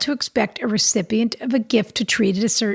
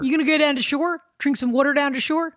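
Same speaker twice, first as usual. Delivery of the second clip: higher-pitched Philly local asking questions; Philadelphia accent; 4 semitones higher than usual. Retold or imitated. Host plays a local heading to the shore, Philadelphia accent. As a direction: talk like a Philadelphia local off to the beach. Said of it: "I like to do accents".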